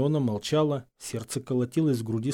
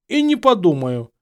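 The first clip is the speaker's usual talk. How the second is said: loud, raised retort; defiant refusal; 6 semitones higher, 8 dB louder than usual. A narrator's reading. Harry refusing to leave, loudly defiant. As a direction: dig in your heels angrily.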